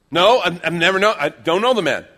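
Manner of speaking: loud, high-pitched protest; insistent denial. Acted. Peter denying Jesus to a questioner, loud and insistent. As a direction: deny it hotly, tripping over the words.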